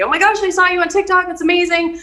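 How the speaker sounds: high, quick excited gush. Retold or imitated. People reaching out after seeing her on TikTok, excited. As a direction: imitate a thrilled acquaintance.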